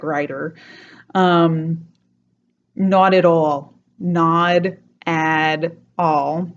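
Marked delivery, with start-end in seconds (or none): 2.7-3.7 not at all with both Ts flapped into Ds, nod add all; New York accent, not at all as nod-a-dall. Shown as a demonstration. Teacher demos flapped Ts in not at all. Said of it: "two Ds there".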